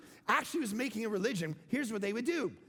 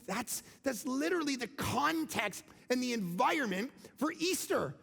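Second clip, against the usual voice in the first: usual speaking voice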